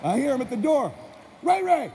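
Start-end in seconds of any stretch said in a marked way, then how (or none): 1.4-1.9 high, loud yell across the yard; calling yell. Retold or imitated. Neighbor dad hollering for his son, a high loud yell. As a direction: holler for the boy to come out. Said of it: "That's how he yelled".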